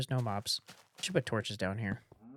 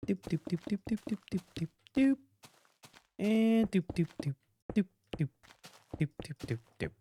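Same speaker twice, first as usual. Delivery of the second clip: playful mouth sound effects; singsong doop noises; 7 semitones higher than usual. Real, not acted. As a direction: hum little beeps while building. Humming while placing blocks.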